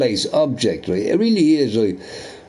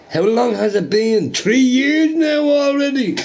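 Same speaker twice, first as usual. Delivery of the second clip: jeering at a failed three-day war; mocking rant; 9 semitones higher than usual. Real, not acted. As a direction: taunt Russia, climbing in pitch. Mocking Russia, voice climbing.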